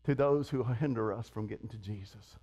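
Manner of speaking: very low, soft pulpit aside; hushed warning. Real, not acted. Preacher warning of those who hinder us, hushed. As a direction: drop low to warn them gently.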